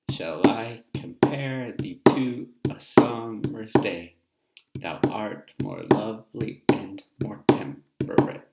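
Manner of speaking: loud, slow, da-DUM sonnet recital; iambic reading. Shown as a demonstration. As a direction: beat out the iambs like a bongo. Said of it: "I'll show you an example".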